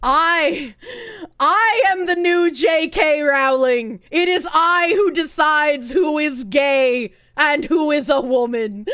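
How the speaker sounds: loud, slow, pompous declaration; hammed-up regal boast; mock grand proclamation. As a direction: announce it like a self-crowned ruler.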